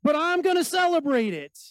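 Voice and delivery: high, loud, hammed-up enthusiasm; mock celebration. Preacher mimicking revelers celebrating drink, mock-excited. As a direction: imitate a reveler proudly cheering it on. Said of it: "there's kind of this celebration of".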